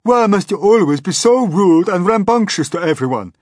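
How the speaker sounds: high, loud reproachful earthworm; scolding earthworm. A narrator's reading. Earthworm scolding the centipede for rudeness, loud. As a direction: tell off the rude one.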